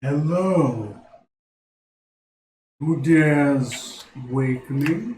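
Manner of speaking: slow, booming gruff voice; sleeping ancient god. Acted. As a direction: rumble it like a woken giant. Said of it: "broad, loud voice, kinda gruff".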